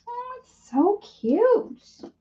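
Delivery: high-pitched, drawn-out coo; swooping, adoring squeal; cooing delight. Real, not acted. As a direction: gush over something tiny and cute. Cooing over the finished card.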